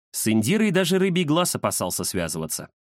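usual speaking voice

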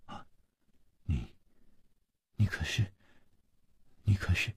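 soft, halting, faintly trembling; hesitant stammer with a touch of tremor. A narrator's reading. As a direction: falter quietly, leaving long gaps. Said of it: "她颤声说".

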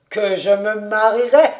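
loud, incredulous question breaking into laughter; amused disbelief. A narrator's reading. Kobus scoffs at David's prediction of marriage, laughing. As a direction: scoff at the idea, about to laugh.

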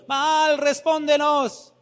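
high pleading cry to Baal; prophets crying to Baal. Retold or imitated. Preacher voicing Baal's prophets, high pleading cry. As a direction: plead high and drawn out to an idol.

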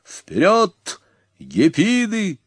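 high, slow rallying shout; rally the warriors with a high call; a chieftain crying freedom; battle cry. A narrator's reading. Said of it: "крикнул Аламан".

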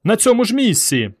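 high urgent yell. A narrator's reading. Boy shouts the time-travel order, high yell. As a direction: keep yelling the order. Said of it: "заволав Петро".

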